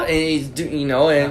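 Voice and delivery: ordinary talk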